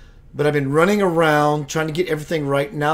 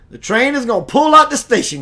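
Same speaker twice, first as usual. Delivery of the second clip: loud hype call; 8 semitones higher, 8 dB louder than usual. Real, not acted. Hyping up the live audience.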